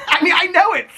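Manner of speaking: laughing through the words. Real, not acted. Laughing at himself over his bedtime theory. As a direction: giggle while admitting it.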